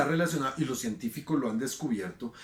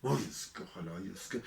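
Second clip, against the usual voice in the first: muttered mock curse; 7 semitones lower, 10 dB quieter than usual. Mocking people who curse.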